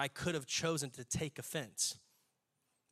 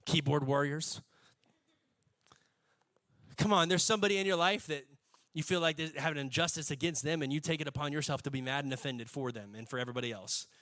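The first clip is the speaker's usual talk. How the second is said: ordinary talk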